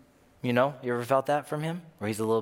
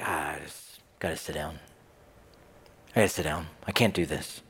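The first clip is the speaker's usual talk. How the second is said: weary sigh; 10 semitones lower, 4 dB quieter than usual. Retold or imitated. Weary believer giving up.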